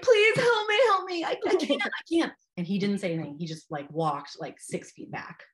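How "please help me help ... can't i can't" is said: high-pitched, imitated pleading; retold freak-out. Labouring mother begging her husband for help, acted panic. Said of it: "I felt like I was freaking out".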